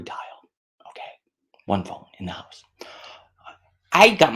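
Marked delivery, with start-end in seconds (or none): 1.7-2.6 unvoiced whisper; whispered aside. Real, not acted. Whispered aside to viewers about being old. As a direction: drop it to a hushed whisper.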